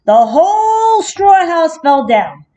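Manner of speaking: ordinary delivery, nothing marked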